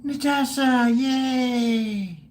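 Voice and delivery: high, drawn-out happy call; excited cheer. Real, not acted. Streamer cheering a viewer who turns up, excited cheer. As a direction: cheer the name up high and hold it.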